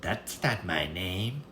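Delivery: drawn-out mocking refusal; smug imp. Little man refuses again, slow and soft. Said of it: "said again and again".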